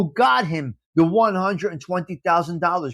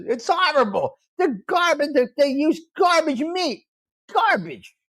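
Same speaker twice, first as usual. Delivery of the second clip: disgusted rant; 8 semitones higher than usual. Real, not acted. High, disgusted ranting about Jersey Mike's.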